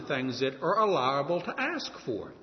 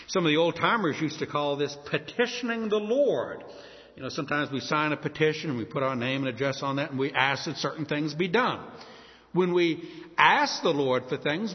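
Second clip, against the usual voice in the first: ordinary delivery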